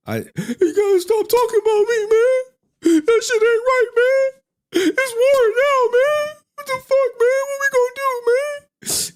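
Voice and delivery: very high and loud, whining about war; squeaky put-on aggrieved voice. Character to haters, squealing loud that it's war now. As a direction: squeal it out like a wounded wannabe rapper.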